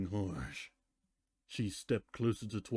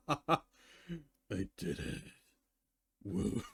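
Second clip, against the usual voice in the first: giddy laughter; 6 semitones higher, much slower than usual. Acted. Twilight celebrates banishing Celestia.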